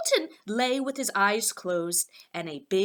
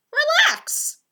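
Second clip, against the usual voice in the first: much higher, louder mock calm; teasing Poppleton; 6 dB louder than usual. A narrator's reading. Poppleton calling after fleeing Fillmore, high and teasing. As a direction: turn his own word back on him.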